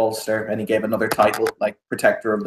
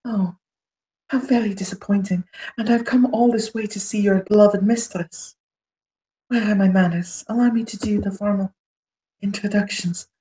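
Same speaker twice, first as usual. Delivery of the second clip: silky condescension; disappointed noble; 9 semitones higher than usual. Acted. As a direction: pour on mock disappointment. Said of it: "as he says".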